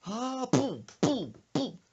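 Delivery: high-pitched cry, then popping booms; imitated attack noises. Son charging in with pillowcase, mock battle cry. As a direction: voice the blows as booms. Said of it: "and goes".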